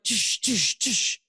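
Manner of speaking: spaced whooshing bursts; imitated flash sounds. Man reliving his existential crisis, imitated flashes. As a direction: puff out short flashback noises.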